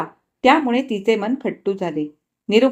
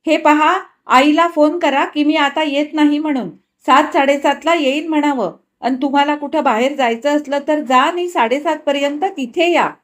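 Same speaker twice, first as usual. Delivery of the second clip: higher, louder brisk instructions; Nirupama giving orders; 6 semitones higher, 5 dB louder than usual. Acted. Wife instructing husband to phone her mother, brisk. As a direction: tell him what to do.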